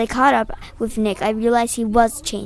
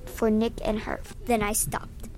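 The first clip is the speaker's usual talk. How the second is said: plain reading voice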